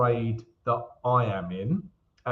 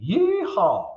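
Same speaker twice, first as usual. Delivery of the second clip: a light, playful cowboy whoop; mock rodeo yeehaw, pitched up; 7 semitones higher, 4 dB louder than usual. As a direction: holler it like a cowboy back in the saddle. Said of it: "That was my cowboy saddle reference impression".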